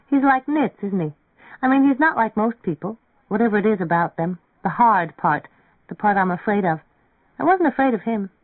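put-on a young girl confiding, high and hesitant; young woman's voice. A narrator's reading. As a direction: voice her as a timid young girl feeling her way. Narrator voicing young Mary Ann.

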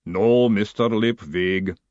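slow, heavy clay-giant voice; golem rumble. Acted. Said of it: "the golem rumbled".

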